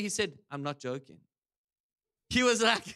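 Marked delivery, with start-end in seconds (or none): 0.5-1.2 very low, soft deadpan; imitated grave seriousness, hushed and low; dead serious. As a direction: drop right down and mean every word. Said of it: "as serious can be".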